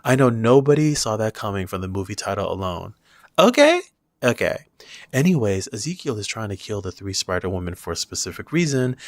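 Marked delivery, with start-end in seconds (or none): 3.4-3.8 high-pitched questioning. Acted. Reviewer joking after saying nobody saw it coming, high-pitched questioning. High echo of okay, then plain.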